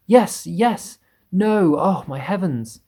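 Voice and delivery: panicked. A narrator's reading. Panicked bug squabbling.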